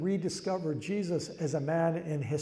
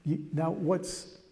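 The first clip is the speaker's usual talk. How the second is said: normal talking voice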